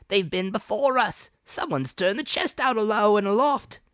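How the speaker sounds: high, loud rough pirate report; alarmed pirate. Acted. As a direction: shout the bad news down. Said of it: "he cried".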